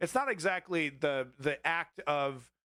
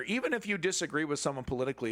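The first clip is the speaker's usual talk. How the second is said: ordinary delivery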